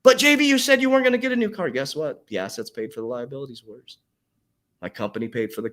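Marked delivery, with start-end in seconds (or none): 0.0-1.7 much higher, loud and fast; mock heckler. Coach voicing a viewer accusing him, high, loud, fast. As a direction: squeal it like an accusing fan.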